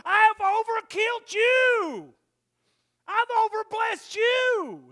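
slow, high, loud booming shout; God thundering. Retold or imitated. God rebukes the preacher with a slow shout. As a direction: boom it down from heaven. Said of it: "And God's going".

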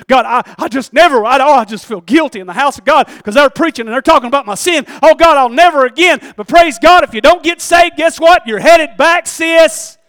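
loud, high, mounting revival preaching; full-force preaching. Real, not acted. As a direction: cry it out over the congregation.

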